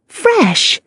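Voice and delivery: high scoffing "Fresh?"; cynical exclamation. A narrator's reading. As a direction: scoff at the word. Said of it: "exclaimed with a cynical snort".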